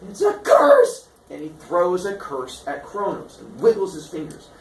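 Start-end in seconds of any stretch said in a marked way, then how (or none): 0.1-1.1 high voice building to a loud cry; spring the trick on your victim; hammed-up, high-pitched gotcha; triumphant reveal. Father springs curse on son.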